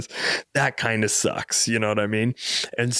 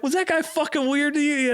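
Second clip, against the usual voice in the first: incredulous question. Retold or imitated. Driver asks about weird passenger.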